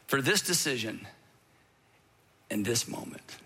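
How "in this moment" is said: usual speaking voice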